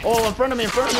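urgent high loud callout; panicking gamer. Real, not acted. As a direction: shout the warning, alarmed. Enemy spotted close by.

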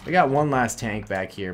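usual speaking voice

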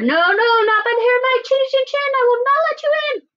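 high, squeaky pig refusal; defiant little pig. Acted. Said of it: "And he grunted and answered".

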